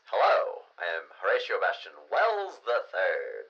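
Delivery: loud radio-filtered intro; radio voice effect. Shown as a demonstration. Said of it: "radio sound effect".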